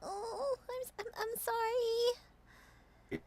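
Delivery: high, slow, near-crying apology; tearful sorry. Acted. Miki sees the kobold she hurt lying still, high tearful apology. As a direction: choke out the apology.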